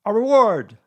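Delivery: high, booming-loud exclamation. A narrator's reading. Owl talking over Pooh, very loud. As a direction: drown out the interruption. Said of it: "said Owl very loudly".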